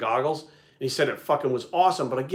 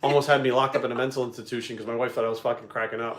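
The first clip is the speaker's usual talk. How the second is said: ordinary talk, nothing marked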